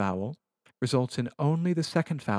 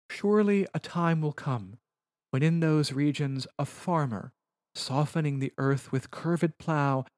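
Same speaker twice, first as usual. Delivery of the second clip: higher, measured reading of verse; poetry reading; 3 semitones higher than usual. Acted. Teacher reading Virgil's passage in English translation, measured poetry reading. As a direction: read it as solemn verse, slowing as it goes.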